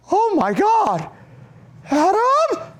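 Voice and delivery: very high, loud imitated shriek; horrified mom. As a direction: cry out the name as an appalled mother. Mom finds the condom.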